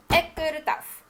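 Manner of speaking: king forbids the egg plan, mock stern; stern refusal. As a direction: put your foot down as king.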